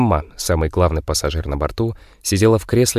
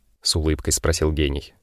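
ordinary delivery, nothing marked

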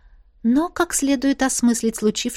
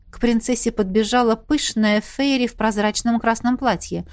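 ordinary delivery

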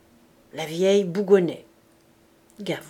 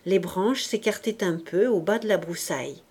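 ordinary delivery, nothing marked

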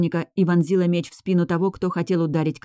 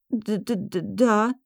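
frightened stammer on the first sound; stuttering; 5 semitones higher than usual. A narrator's reading. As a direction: stutter out a scared yes. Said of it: "заикаясь".

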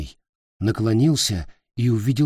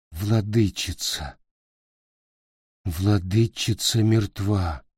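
slow grief; 2 semitones lower, slower than usual. Acted. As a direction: say it as if numb with loss. Grieving Peter.

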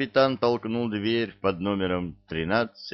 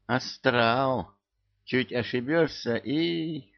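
ominous hint; 6 semitones higher, slower than usual. A narrator's reading. House spirit ominous.

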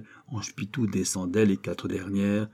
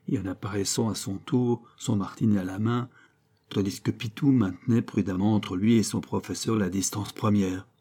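usual speaking voice

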